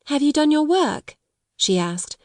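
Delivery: put-on high, practical young girl; different tone. A narrator's reading. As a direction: switch to a brisk, sensible tone. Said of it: "spoke in a different tone".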